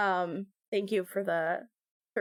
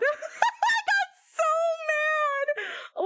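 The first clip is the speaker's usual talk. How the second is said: laughing outburst; much faster than usual. Real, not acted. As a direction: burst out laughing on mad. Laughing retelling of rage.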